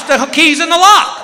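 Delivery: panicked. Retold or imitated. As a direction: blurt it in alarm. Sudden panic retold.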